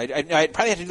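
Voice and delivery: usual speaking voice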